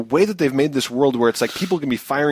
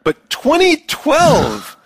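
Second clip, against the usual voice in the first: incredulous; 13 semitones higher, 5 dB louder, much slower than usual. Real, not acted. Incredulous at early game reveal.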